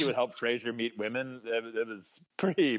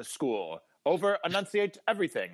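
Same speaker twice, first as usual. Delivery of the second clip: higher, precise, over-enunciated; crisp over-enunciated actor voice; David Hyde Pierce; 6 semitones higher than usual. Pretend. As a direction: speak like a prim sitcom psychiatrist. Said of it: "that was a really good David Hyde Pierce".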